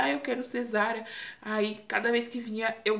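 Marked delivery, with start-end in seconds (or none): none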